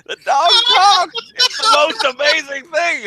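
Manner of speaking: high, loud, slow awe; mock amazed boss. Acting out the boss amazed by a talking dog, high loud awe. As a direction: marvel like a stunned old boss.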